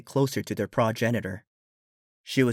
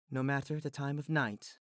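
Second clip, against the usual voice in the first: much softer, drowsy and kind; sleepy tender voice; 3 semitones higher, 12 dB quieter than usual. Acted. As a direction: reassure while half-asleep.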